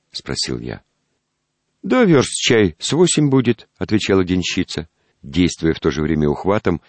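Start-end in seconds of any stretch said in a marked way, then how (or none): 1.8-3.7 peasant woman voice. Acted.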